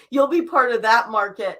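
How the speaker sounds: ordinary talk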